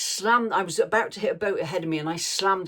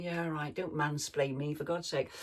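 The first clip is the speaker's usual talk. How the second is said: muttered retold irritation; 7 dB quieter than usual. Boater silently answering a man explaining gears, soft irritated aside. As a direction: grumble it under your breath, as a remembered thought.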